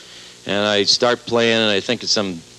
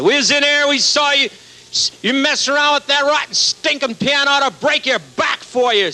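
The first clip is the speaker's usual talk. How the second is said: much higher, shrill-pitched angry lady voice; furious old lady; 13 semitones higher than usual. Retold or imitated. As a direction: play an outraged old woman scolding him. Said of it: "Then they get real mad".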